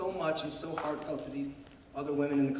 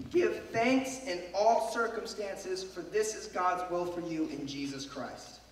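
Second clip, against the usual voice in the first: scripture reading; slower than usual. Shown as a demonstration. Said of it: "it says".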